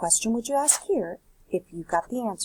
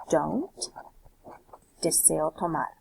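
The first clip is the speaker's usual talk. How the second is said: ordinary talk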